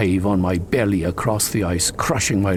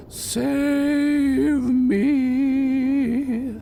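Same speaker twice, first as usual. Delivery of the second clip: long, high, drawn-out ghost moan; ghostly moan. Acted. Henry teasing Gamaliel from the grove, high ghostly moan. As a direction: moan it like a lost spirit. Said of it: "Henry moaned".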